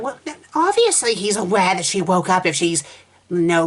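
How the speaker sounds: ordinary delivery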